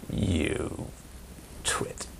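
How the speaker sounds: a low, hushed, breathy jab of an insult; muttered insult. Retold or imitated. Someone mutters an insult at you, hushed. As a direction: toss the insult under your breath.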